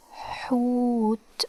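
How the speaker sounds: "hoot" very slow, with long h and oo; drawn-out demonstration. Shown as a demonstration. Teacher sounds out the new Arabic word "hoot", drawn out slowly. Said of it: "so it's pronounced like hu".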